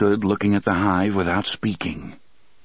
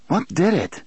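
Eddie's alarmed voice; 10 semitones higher than usual. A narrator's reading.

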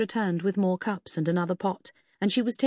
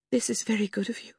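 croaky voice; 4 semitones higher, faster than usual. A narrator's reading. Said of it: "she said in her croaky voice".

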